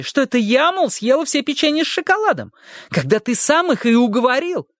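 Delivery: a bossy housekeeper demanding entry; angry maid shouting. A narrator's reading.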